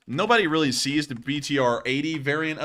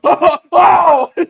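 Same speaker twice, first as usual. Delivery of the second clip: huge, high screaming guffaw; exaggerated booming laugh that turns into a scream; rich people's laugh; 16 dB louder, much slower than usual. Shown as a demonstration. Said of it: "But when rich people laugh, they go".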